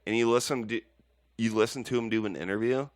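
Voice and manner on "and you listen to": normal talking voice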